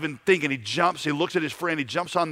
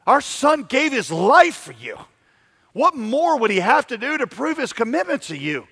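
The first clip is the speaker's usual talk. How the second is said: angry mother; 8 semitones higher, 4 dB louder than usual. Retold or imitated. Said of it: "the mother gets really angry".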